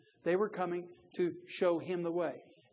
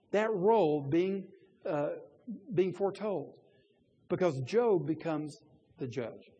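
ordinary talk, nothing marked

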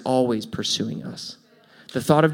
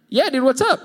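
much higher, breezy "Yeah, dude"; casual friend; 11 semitones higher than usual. Retold or imitated. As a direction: act the clueless buddy. Acted reply of friend.

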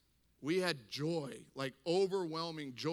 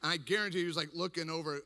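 normal talking voice

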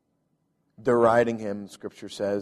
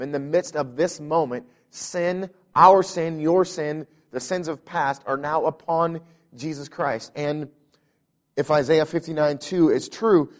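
higher, louder preaching on sin and separation; preaching at full force; 4 semitones higher, 5 dB louder than usual. Acted. Pastor preaching to the church on sin, fervent. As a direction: drive it home to the congregation.

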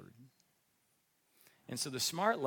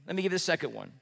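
ordinary delivery

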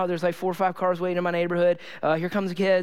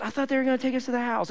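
higher, quick, unforced innocent reply; innocent child; 6 semitones higher, faster than usual. Retold or imitated. Henry to dad after missing his stop, innocently. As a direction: imitate a kid's guileless explanation. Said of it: "just innocently".

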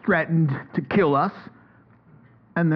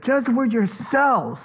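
slow, higher, emphatic challenge as Peter; bold Peter; 6 semitones higher than usual. Pretend. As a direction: challenge the council boldly. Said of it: "He said".

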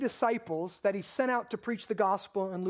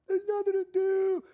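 put-on whining bored kid; 8 semitones higher than usual. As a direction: imitate a bored son's wail. Son whining about being bored.

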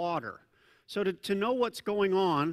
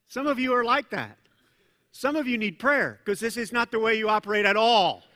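usual speaking voice

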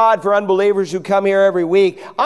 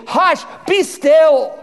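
calm command; 6 semitones higher, much slower than usual. Retold or imitated. Jesus calms the sea.

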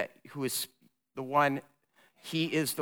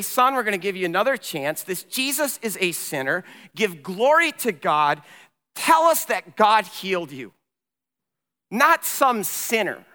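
raised, pressing demands with punched words; stern interrogation; 4 semitones higher, 5 dB louder than usual. Acted. Religious leaders interrogate the healed man again, stern and insistent. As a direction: lean on him like an interrogator, building to the end. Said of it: "they interrogate him all over again".

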